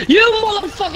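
high, loud cursing scream; furious yell. Real, not acted. Gamer cursing the zombie that downed him, furious scream. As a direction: explode at the screen. Raging at enemy in game.

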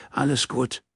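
hiding tears. Acted. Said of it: "ihre Tränen nicht sah".

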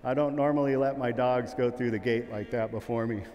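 normal talking voice

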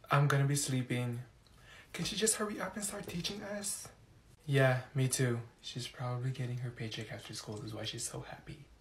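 soft, low muttering aside; whispering. Acted. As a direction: mutter about the teacher. Students whispering.

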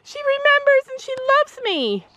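very high, thrilled mock squeal; retold excited scream. Telling of an overwhelming dream of being loved, excited squeal. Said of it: "I was, like, screaming".

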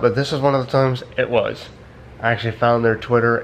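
ordinary talk, nothing marked

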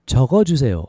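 joined-up syllables in one quick phrase. Shown as a demonstration. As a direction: run it together without gaps. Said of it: "So a little faster".

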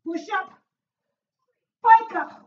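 loud, high exercise call; called-out cue. Real, not acted. Trainer calling the move to the class, loud call.

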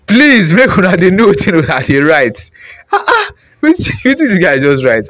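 high, laughing plea to commenters; amused exasperation. Real, not acted. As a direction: plead through laughter, baffled by the wording. Laughing at a garbled comment he reads.

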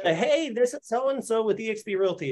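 hammed-up sales pitch; cheesy sales voice. Shown as a demonstration. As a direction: parody the canned realtor intro. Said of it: "Not the".

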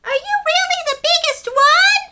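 high, loud curious little penguin voice; a tiny penguin full of questions. Acted. Story reader voicing small penguin Pipkin asking big questions. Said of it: "Pipkin asks".

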